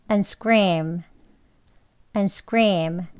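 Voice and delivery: and said weakly as a light en, no d sound. Shown as a demonstration. Teacher demonstrates the reduced and for the students. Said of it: "약하게 또 발음해서요".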